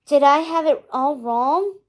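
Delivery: usual speaking voice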